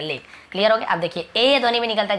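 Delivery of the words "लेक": "लेक" as lake; teacher confirming the narrow vowel of "लेक"; correct ay pronunciation. Shown as a demonstration. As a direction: repeat "लेक" with long ay. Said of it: "तो लेक पढ़ेंगे".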